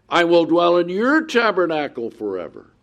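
bright triumphant pulpit reading; preaching scripture. Acted. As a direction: ring it out over the congregation. Repeats verse with emphasis.

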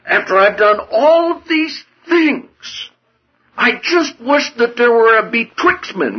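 hammed-up indignant protest; voicing Job's angry grievance against God, pitched high. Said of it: "he got so angry".

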